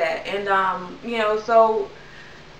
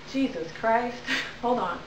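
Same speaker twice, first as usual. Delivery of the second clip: composing herself; 6 dB quieter than usual. Real, not acted. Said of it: "I'm getting emotional about this".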